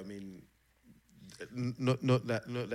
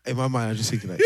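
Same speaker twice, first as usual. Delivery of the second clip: ordinary delivery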